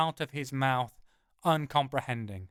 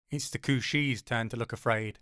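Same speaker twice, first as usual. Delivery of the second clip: plain reading voice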